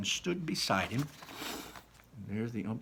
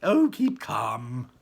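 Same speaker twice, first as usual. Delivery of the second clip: slow, louder soothing voice; calm her down, slowly; lightly voiced Wonka easing a panic; 8 dB louder, much slower than usual. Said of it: "Mister Wonka said".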